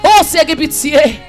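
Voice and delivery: usual speaking voice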